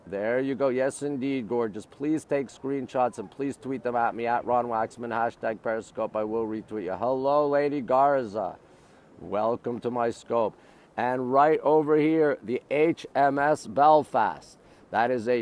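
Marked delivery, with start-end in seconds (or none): none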